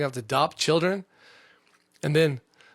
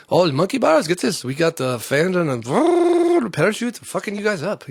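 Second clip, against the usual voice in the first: put-on slow, louder, garbled made-up Middle East accent; mixed fake accent; 6 semitones higher, 6 dB louder, slower than usual. Mocking proud Middle East video voices, fake accent. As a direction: mash accents together, hammed-up. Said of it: "I have no idea what accent that was".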